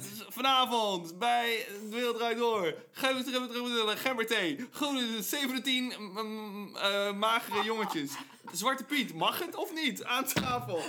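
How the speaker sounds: loud, high, rapid-fire host announcement; talk-show host impression. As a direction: rattle off random show teasers. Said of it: "Luistert iemand nog naar wat hij echt zegt in die snelle zin?".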